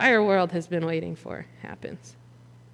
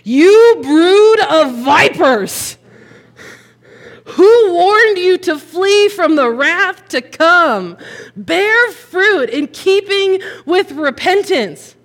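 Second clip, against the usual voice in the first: higher, louder, slow thundering condemnation; furious rebuke; 8 semitones higher, 11 dB louder, much slower than usual. Acted. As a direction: hurl it at the crowd like a prophet. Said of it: "Nobody talks to these guys like that".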